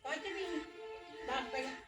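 put-on high, sing-song coaxing to a toddler; baby talk. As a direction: coax the little one gently. Mother coaxing her little child. (ਬੱਚੇ ਨਾਲ ਤੋਤਲੀ ਲਾਡ ਭਰੀ ਬੋਲੀ)

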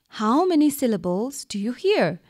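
usual speaking voice

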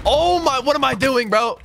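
real high, loud outburst; dismayed yell. Real, not acted. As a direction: yell it out in alarm at your own blunder. Yells at own mistake in game.

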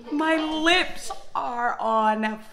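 loud, slow cry of pain; burning lips, let it out; pained outburst. Real, not acted. Lips burning from spicy noodles.

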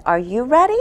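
ordinary delivery, nothing marked

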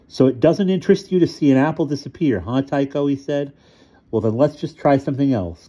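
put-on high-pitched, needling child voice; taunting bully boy. A narrator's reading. Furious Ludwig taunts Tycho over his trick, high and needling. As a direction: goad him like a schoolyard bully.

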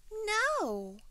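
very high, loud little-bird voice; baby bird's refusal. A narrator's reading. Baby bird answering the giraffe, high refusal.

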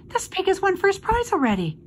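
put-on high-pitched boy's outcry; high, dismayed exclamation; crying out. A narrator's reading. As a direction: announce it in a high cry. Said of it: "cried Avery".

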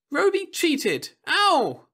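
put-on high, sulky child; whining little girl. A narrator's reading. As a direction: whine like a spoiled kid. Girl pulled away crying out.

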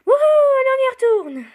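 loud, high-pitched whoop; super excited. Real, not acted. Player heading back into the game, super excited cheer. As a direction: whoop and cheer at full volume. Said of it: "je suis super excitée".

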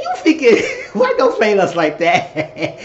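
high, loud, laughing, slowing down; laughing outrage. Retold or imitated. Friend scolding a prankster, loud laughing shriek. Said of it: "I said".

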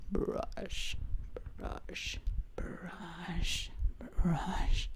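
whispered zombie rasp; breathy undead whisper. Pretend. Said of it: "my zombie voice is getting the control".

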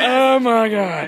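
high, drawn-out, loud amazement; real disbelief as the canoe floats; stretched-out overjoyed oh my God; thrilled gasp. Real, not acted. Canoe floats, cheering erupts.

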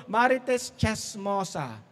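Marites Chess Mosa said very slowly; slow, drawn out. Shown as a demonstration. Preacher dragging out the joke name for the crowd, slow. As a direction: stretch out each part of Marites Chess Mosa. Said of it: "ang tagal".

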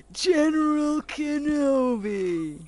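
booming drawn-out villain greeting; General Grievous. Acted. Streamer greeting viewers as the Star Wars villain, hammy impersonation. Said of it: "That was my General Grievous".

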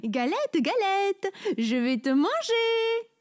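high, slow hungry rabbit; rabbit crying out. A narrator's reading. Rabbit to the cake, high and greedy. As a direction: climb high and stretch out the threat. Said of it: "crie le lapin".

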